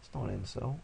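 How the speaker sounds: normal talking voice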